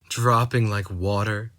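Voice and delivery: ordinary delivery, nothing marked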